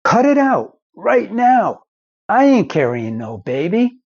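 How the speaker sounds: high startled yelp of disbelief, then indignant; a guy told he's pregnant, squeaking in outrage; shocked disbelief. Retold or imitated. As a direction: recoil at the news and refuse it outright. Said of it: "And the guy would go".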